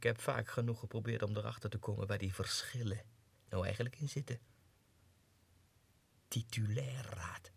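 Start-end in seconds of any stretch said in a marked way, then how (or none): 6.3-7.5 brooding whisper. Acted. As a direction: whisper the word to yourself. Broods on his rank. (piekerend gefluisterd)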